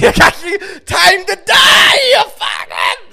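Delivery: high, drawn-out yell, dropping at the end; furious mock shout. Boy drowning another in a sink, furious mock shout. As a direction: bellow it like a hammed-up attacker.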